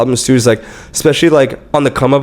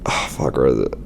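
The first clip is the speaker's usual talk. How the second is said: under-the-breath whisper while scrolling; muttered search; 11 semitones lower, 7 dB quieter than usual. Real, not acted. Hunting for the message on his phone, a whispered aside. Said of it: "Let me see if I can pull it up".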